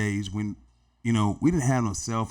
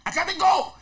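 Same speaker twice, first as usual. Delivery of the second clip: much higher, laughing. Retold or imitated. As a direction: fire it off like the star. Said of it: "He was saying".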